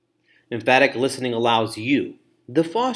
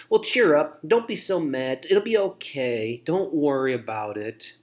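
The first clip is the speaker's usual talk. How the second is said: mock cheering-up; 3 semitones higher than usual. Poor listener shutting down someone's feelings, mock cheering-up. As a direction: brush feelings aside with hammed-up reassurance. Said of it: "shutting down their feelings".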